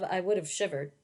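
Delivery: ordinary delivery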